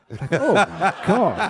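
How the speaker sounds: high, slow gasp of mock shock, laughing; laughing fake outrage. Real, not acted. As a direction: play up the scandal with a laughing, stretched oh my God.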